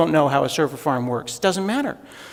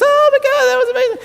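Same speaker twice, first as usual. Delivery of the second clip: very high, louder squeal of delight; mock gush; 5 dB louder, faster than usual. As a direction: squeal like a starstruck fan. Mocking audience gush at British guy.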